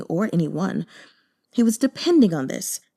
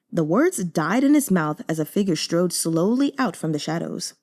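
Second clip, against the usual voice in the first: usual speaking voice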